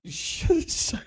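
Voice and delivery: high squeak through laughter; laughing too hard to speak; after laughing hard. Real, not acted. Breaking up with laughter.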